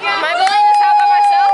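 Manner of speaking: excited. Real, not acted. After the birthday song.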